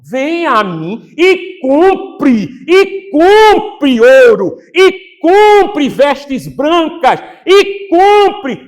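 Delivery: very slow, loud, drawn-out pleading call; preaching Jesus's plea to the church at full force. Acted. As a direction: stretch every word out as a loud invitation.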